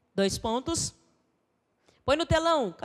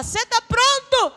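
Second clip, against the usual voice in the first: slow, high questioning voice as God; a slow lifted call as God; God asking; 12 semitones higher, 7 dB louder, slower than usual. Acted. Preacher voicing God asking the believer, slow and high.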